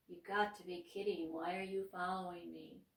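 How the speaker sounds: ordinary delivery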